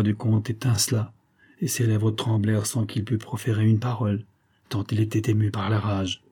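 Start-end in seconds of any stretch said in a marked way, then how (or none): none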